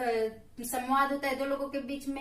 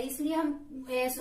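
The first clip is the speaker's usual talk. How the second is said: ordinary talk, nothing marked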